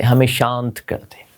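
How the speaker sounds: usual speaking voice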